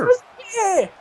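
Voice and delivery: high-pitched echoed shout; excited shout. Real, not acted. Co-commentator echoes the call.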